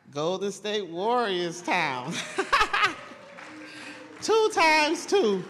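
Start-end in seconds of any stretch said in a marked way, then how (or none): 2.2-4.2 gleeful high laugh. Real, not acted. Laughs before rival taunt. 4.2-5.4 gloating. Real, not acted. Gloating over two titles.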